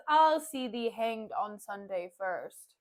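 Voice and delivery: low, slow, scornful line; Caterina's scornful retort. Retold or imitated. Kate sneering at Petruchio's wedding boast, slow and low. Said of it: "Caterina says".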